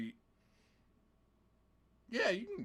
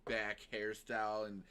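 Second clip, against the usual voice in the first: ordinary delivery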